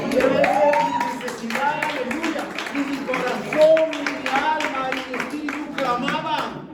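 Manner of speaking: loud, high impassioned preaching; preaching at full force. Acted. Pastor preaching to the congregation, loud and fervent. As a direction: build to a fervent climax, crying out.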